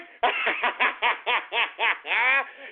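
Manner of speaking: long staccato cackle; drawn-out gloating laugh; mocking cackle. Real, not acted. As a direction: laugh derisively in rapid even bursts. Laughs at listeners he just insulted.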